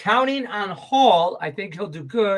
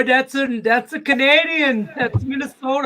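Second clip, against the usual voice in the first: higher, louder hammed-up Canadian accent; teasing a co-host in a bad Canadian accent; mock Canadian accent; 8 semitones higher, 5 dB louder than usual. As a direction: toss off a jokey Canadian accent. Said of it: "I have so many accents going through my head".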